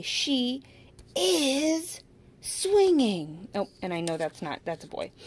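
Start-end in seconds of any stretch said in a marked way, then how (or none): 0.0-3.5 "She is swinging" higher, louder, very slow; emphatic modeling. Shown as a demonstration. Teacher modeling the correct sentence for a child, emphatic. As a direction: sing out the right sentence slowly. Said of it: "We have to say".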